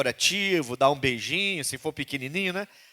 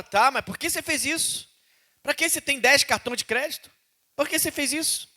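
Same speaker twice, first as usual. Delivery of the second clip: much higher, searching question; God asking why; 12 semitones higher than usual. Retold or imitated. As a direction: ask with pained insistence. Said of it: "Deus tá falando isso".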